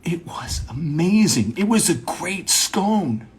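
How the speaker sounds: high, drawn-out intense praise; mock big talk. Praising a scone as big talk, intensely. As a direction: stress it as if momentous. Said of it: "have small talk as if it's big talk".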